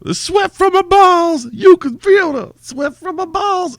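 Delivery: squeaky high falsetto, loud and hammed-up; silly falsetto. Host answering a candle question in a mock falsetto. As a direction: shriek it like a cartoon diva.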